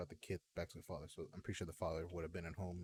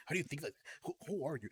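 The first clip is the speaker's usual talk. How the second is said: imitating friend; 8 semitones higher, faster than usual. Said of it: "He's just like".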